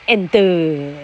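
and as en-de with an added final vowel; and with a Taiwanese accent; Taiwanese-accented English. Shown as a demonstration. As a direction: say and in two beats, Taiwanese style. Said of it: "台湾人念作".

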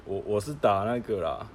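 ordinary talk, nothing marked

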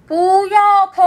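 high, loud sing-song yell; loud shout. Acted. Big seal chasing the otter, yelling loud and high. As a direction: bellow after the fleeing otter. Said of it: "大海豹大声地说".

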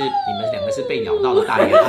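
high laughing exclamation. Real, not acted. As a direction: burst out laughing. Laughing at kids' yelps.

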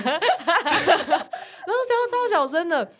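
high, slow words through laughter; laughing. Real, not acted. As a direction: squeeze it out between laughs. Laughing description.

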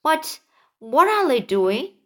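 usual speaking voice

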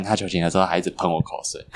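normal talking voice

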